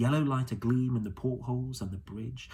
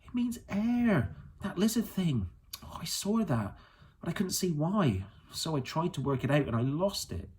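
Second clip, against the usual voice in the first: put-on excited girl; 7 semitones higher than usual. A narrator's reading. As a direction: tumble out the discovery. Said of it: "suddenly excited".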